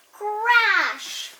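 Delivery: shouted sound effect, high and loud; loud crash. A narrator's reading. Reading to class, shouting the crash loudly. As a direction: belt out the crash like blocks tumbling.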